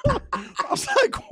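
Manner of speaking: high squealing laugh into slow words; can't stop laughing mid-sentence; cracking up while starting the story. Real, not acted. S2 cracks up over John.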